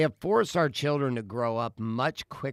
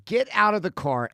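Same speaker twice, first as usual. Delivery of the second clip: higher-pitched retold order; angry mom; 7 semitones higher, 4 dB louder than usual. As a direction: imitate a fed-up mother's command.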